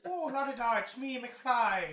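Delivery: a cartoonish stage-Irish lilt; terrible Irish accent. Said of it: "my terrible Irish accent".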